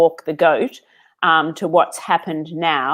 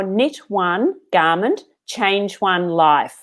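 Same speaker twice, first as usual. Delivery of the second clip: slow and deliberate; slower than usual. Real, not acted. Explaining the business name's meaning.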